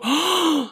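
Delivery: a sharp high gasp of alarm; mock gasp. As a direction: gasp like you've spotted something. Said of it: "And you hear some guy go".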